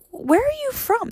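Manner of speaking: high-pitched, drawn-out disbelief; incredulous. Retold or imitated. Acted disbelief at rude woman.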